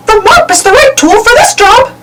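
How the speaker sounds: high Mickey voice; cheerful Mickey announcing the tool; Mickey announcing the mop. A narrator's reading. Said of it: "said Mickey".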